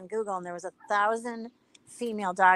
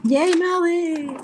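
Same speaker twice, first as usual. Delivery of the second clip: high, loud, drawn-out cheer; delighted cheer; 10 semitones higher, 5 dB louder, much slower than usual. Real, not acted. Cheering for Melly who saved the day, delighted. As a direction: cheer her on, stretching it out high.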